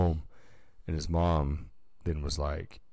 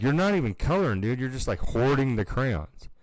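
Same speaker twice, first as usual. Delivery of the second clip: teasing scold; 7 semitones higher, 3 dB louder than usual. Retold or imitated. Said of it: "I'm like".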